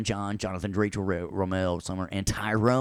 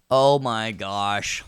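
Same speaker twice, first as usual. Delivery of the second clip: OH MY GOSH stretched out slowly and louder; drawn-out exclamation; 2 semitones higher, 4 dB louder, much slower than usual. Pretend. Teacher reacting to his own joke, drawn-out exclamation. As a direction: draw out every word of "Oh, my gosh".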